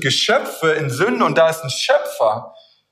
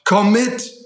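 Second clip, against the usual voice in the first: higher, insistent call to follow; urgent masked rescuer; 5 semitones higher, slower than usual. Acted. Masked stranger waking a sleeper to rescue him, urgent. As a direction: play the rescuer pressing a sleeper to come along. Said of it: "Er nuschelt und sagt".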